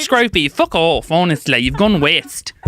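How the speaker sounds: mock-fight in Cockney; playful Cockney scolding; Cockney accent. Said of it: "can we do it in that accent".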